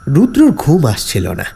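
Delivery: ordinary delivery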